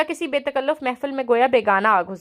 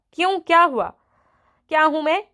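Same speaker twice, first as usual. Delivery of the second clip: put-on child's cry out; 5 semitones higher than usual. A narrator's reading. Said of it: "بدو چیخ اٹھتا".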